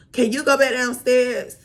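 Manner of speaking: mock her scrunched-face snap; mocking nasty attitude. Mocking the nail tech's rude request, in a nasty tone. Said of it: "balled up her face".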